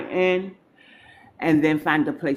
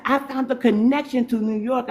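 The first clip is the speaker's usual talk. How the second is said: lightly voiced excited friend, pitched up; quoting Jerika; 6 semitones higher than usual. Telling the live audience what Jerika announced, higher mock friend voice. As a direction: echo her news in a bright, higher voice. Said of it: "She said".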